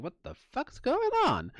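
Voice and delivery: high startled outburst at the bangs; startled alarm. Retold or imitated. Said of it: "I'm like".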